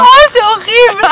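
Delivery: high, loud cry of disgust. Real, not acted. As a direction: recoil in real disgust. Tasting vomit-flavoured candy.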